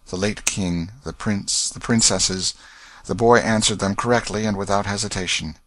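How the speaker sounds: ordinary delivery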